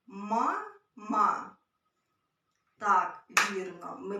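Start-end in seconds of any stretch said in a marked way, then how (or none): 0.1-1.6 said louder and clearly; emphasised test word. Shown as a demonstration. Teacher calls out a test word for children to clap to. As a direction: give the word a clear, loud delivery. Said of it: "А, наприклад, слово".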